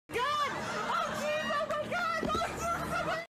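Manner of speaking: very high squealing oh my God; shrieking laugh. Real, not acted. Player shocked by a mishap, squealing laugh. Shocked reaction while playing.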